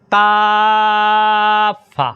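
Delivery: কাফা chanted very slowly and held; long chanted কাফা; drawn-out recitation. Shown as a demonstration. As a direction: stretch কাফা long like Quran reading. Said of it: "হার উচ্চারণ করতে হবে".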